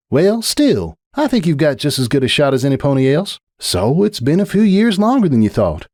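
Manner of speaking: lightly voiced farm mare, upbeat; a touch of a cheering friend; country mare, encouraging. Farm mare encouraging her coltfriend with an example.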